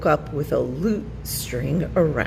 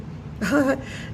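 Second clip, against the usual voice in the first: nervous laugh; 9 semitones higher, 4 dB quieter than usual. A narrator's reading. Fox caught, nervous laugh.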